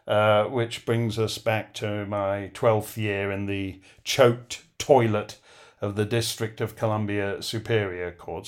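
ordinary talk

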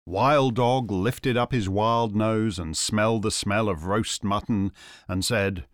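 plain reading voice

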